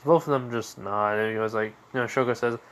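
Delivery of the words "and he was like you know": usual speaking voice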